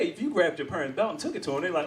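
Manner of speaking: usual speaking voice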